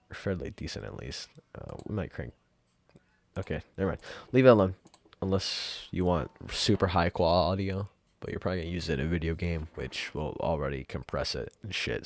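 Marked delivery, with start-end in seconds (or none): none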